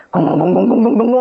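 模仿咚咚的心跳, 又高又响; 用嘴敲出急促的心跳鼓点; 心跳打鼓声. Retold or imitated. 心里打鼓的心跳声. (模仿心跳的咚咚声)

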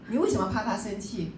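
ordinary talk

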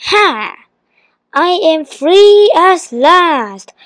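high, slow, triumphant cry; genie's shout. A narrator's reading. Genie bursts free of the bottle, shouting. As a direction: stretch it out in gloating triumph. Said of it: "shouted the genie".

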